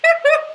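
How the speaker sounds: a high burst of real laughter; sudden laugh. Real, not acted. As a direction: crack up mid-reading. Reading angry entry, cracks up.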